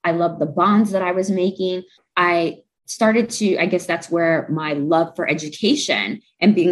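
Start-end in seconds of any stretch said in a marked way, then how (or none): none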